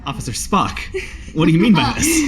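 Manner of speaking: high, laughing mock-serious question; hammed-up Spock query. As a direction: tease like a stiff Vulcan officer. Mocking Spock reply.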